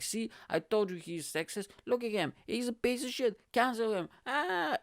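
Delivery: high mocking whiny accuser voice. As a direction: squeal it like an outraged critic. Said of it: "be like".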